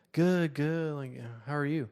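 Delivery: lightly mocked awkward teen; put-on teen voice. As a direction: imitate a stilted, high-pitched reply. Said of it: "give them the voices that I heard".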